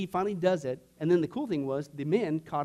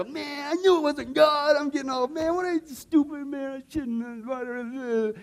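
high, slow wailing self-reproach; hammed-up whining dismay; mock panicked regret; 10 semitones higher, 6 dB louder, slower than usual. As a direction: groan it out, loud and pitched up. Acts panicked regret as she cries.

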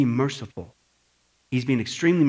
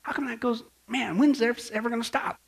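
softer, mildly exasperated muttering; weary complaint; 6 semitones higher, 6 dB quieter than usual. Retold or imitated. Someone watching the world today, softly exasperated. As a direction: sigh it out at the news. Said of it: "you go".

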